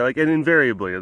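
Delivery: normal talking voice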